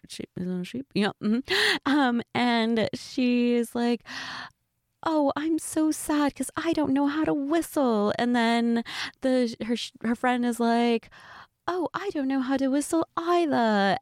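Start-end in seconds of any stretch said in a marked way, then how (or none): 5.0-8.1 high-pitched mock British cartoon accent; Peppa Pig. Retelling a cartoon clip to a friend, fake British accent. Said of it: "don't make fun of my accent". 11.7-14.0 higher-pitched mock British cartoon voice; fake accent. Voicing Peppa's friend from a clip, bad British accent. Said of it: "don't make fun of my accent".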